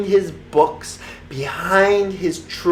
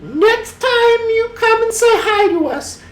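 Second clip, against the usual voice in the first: much higher witch voice; a high, playful cackling witch; storybook witch; 5 dB louder than usual. A narrator's reading. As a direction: Hilda greets Louie in a high witch voice.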